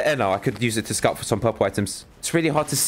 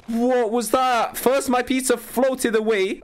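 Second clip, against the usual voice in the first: high, slow, plaintive complaint; wounded, drawn-out high-pitched grievance; aggrieved whine; 11 semitones higher, slower than usual. Real, not acted. Whining about lost pizza.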